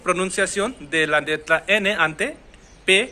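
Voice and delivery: very slow, word by word; slow title read. Shown as a demonstration. Teacher reads the lesson title out slowly. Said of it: "वो title है वो ये है".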